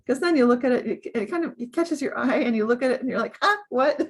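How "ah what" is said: higher, louder double take; mock startled. Someone catching sight of their own note, playfully startled. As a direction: act out a sudden little startle. Acting out being caught by a note.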